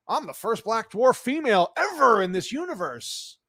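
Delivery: high, sneering mock of a proud actress. Vlogger mocking the show's actress, high sneer. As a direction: lightly parody her bragging.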